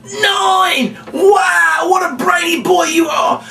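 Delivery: put-on praising the birthday boy's big score; building amazement. Shown as a demonstration. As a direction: build to over-the-top astonishment. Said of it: "My goodness, yellow".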